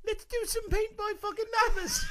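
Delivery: very high, loud mock-female squeal; shouted high-pitched caricature of the co-host; mocking imitation. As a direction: mimic her in an exaggerated falsetto. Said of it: "And you're like".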